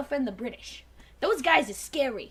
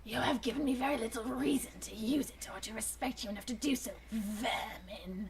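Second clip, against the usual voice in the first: Dinobot whisper; 6 semitones lower, 11 dB quieter than usual. Acted.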